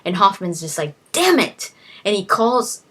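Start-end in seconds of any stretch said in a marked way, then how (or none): 1.1-1.7 frustrated curse. Retold or imitated. Hoffman frustrated after wife leaves.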